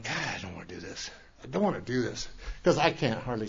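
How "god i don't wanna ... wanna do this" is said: low dread. Retold or imitated. Dreading going back to meeting.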